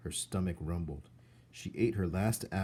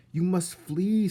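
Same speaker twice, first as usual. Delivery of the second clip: ordinary delivery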